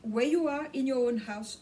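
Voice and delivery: normal talking voice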